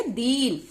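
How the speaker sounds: दीन stretched on a long ee; drawn-out vowel. Shown as a demonstration. Teacher demonstrating the long matra to learners, lengthened दीन. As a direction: draw out the ee of दीन. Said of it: "लंबे समय के लिए बोलेंगे".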